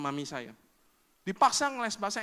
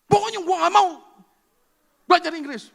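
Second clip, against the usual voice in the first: angry outburst; 3 semitones higher, 10 dB louder than usual. Retold or imitated. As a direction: yell it like a furious child refusing. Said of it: "Satu kali saya marah". (teriakan marah anak yang keras kepala)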